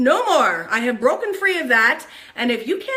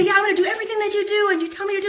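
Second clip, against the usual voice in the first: fast, higher, laughing gush; eager rush; 7 semitones higher, much faster than usual. Retold or imitated. New partner gushing to her, fast and eager. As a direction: rattle it off breathlessly. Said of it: "They say".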